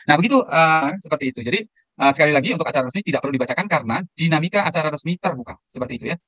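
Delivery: usual speaking voice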